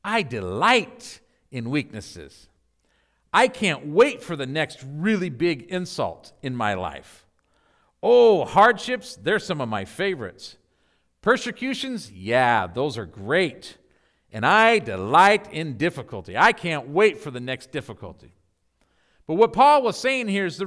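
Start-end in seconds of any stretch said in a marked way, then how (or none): 0.0-2.5 slow, lower, mock-cheerful delight; sarcastic delight. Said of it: "how many of us can say". 3.3-18.3 lower, mock-eager listing of troubles; sarcastic enthusiasm. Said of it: "how many of us can say".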